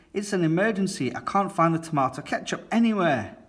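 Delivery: high, fast, breathless pleading; panicked Daddy Pig. Acted. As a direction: rattle it off in a mock panic.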